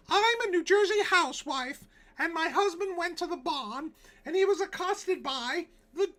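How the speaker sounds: put-on very high, loud falsetto old-timey housewife; 1909 New Jersey housewife. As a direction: shriek it like a frantic 1909 housewife telling of the devil. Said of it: "that's my New Jersey housewife from nineteen oh nine voice".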